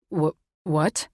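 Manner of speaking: mild startled stammer; stammered, disbelieving question. A narrator's reading. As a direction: trip over the first word. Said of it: "Andre stammered".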